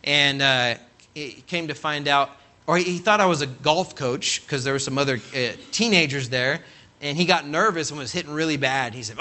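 usual speaking voice

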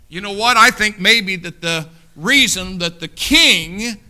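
normal talking voice

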